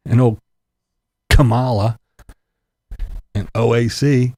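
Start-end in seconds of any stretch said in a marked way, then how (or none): none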